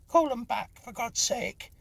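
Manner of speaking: high, loud desperate plea; woman's voice crying out high and loud; frantic cry. A narrator's reading. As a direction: cry out to call them back. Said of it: "cried a woman's voice".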